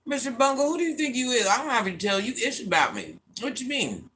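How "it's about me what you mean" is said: ordinary talk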